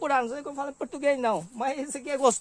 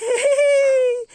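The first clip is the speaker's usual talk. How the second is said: loud high laugh; 10 semitones higher, 6 dB louder than usual. Real, not acted. Laughs after the shout.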